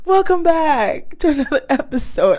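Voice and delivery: loud, still laughing at the botched intro; laughing restart. Real, not acted. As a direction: push the welcome out through giggles. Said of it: "I'm not starting this over".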